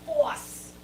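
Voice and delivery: ordinary talk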